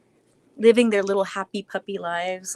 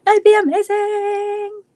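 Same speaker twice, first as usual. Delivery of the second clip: excited gush; 11 semitones higher, 7 dB louder, slower than usual. Real, not acted. Gushing over dream match.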